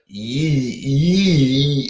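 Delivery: "yee" drawn out high for you; exaggerated Baltimore vowel on "yee"; Baltimore "ooh". Shown as a demonstration. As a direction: stretch "yee" high, fronting the oo. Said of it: "the way they say".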